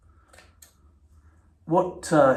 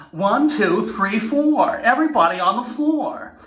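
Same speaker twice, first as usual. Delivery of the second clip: high, loud peppy fitness instructor count; high energetic workout count; Jane Fonda workout voice; 9 semitones higher, 4 dB louder than usual. Retold or imitated. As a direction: count it off like an eighties aerobics tape. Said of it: "Jane Fonda".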